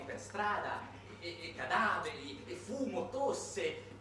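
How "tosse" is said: ordinary delivery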